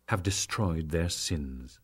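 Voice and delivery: ordinary delivery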